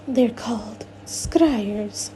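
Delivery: slow, soft correction; correcting Miss Teak. Acted. Miss Teak corrects Dad about her scryers, slow and soft. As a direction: set him straight, slowly and softly. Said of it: "Miss Teak corrects".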